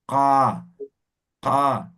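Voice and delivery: slow ka from the throat, no strain. Shown as a demonstration. Teacher showing an easy throat ka. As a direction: let ka come naturally from the throat. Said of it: "pronuncien desde la garganta".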